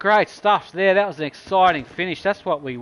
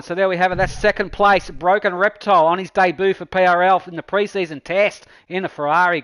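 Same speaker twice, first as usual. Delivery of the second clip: announcer wrap-up; 4 semitones higher, 5 dB louder than usual. Acted. Host wraps up race result.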